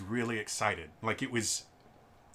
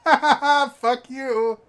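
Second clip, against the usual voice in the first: very high, loud mocking cackle into a curse; gloating laugh; 13 dB louder, slower than usual. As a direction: laugh in the customer's face. Gloating at a rude customer.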